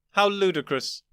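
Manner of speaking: mocking disbelief; scornful surprise. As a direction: scoff at how ludicrous. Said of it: "show surprise".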